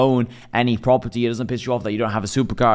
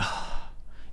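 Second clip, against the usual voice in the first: sigh; 11 dB quieter than usual. Acted. After the run, letting breath out.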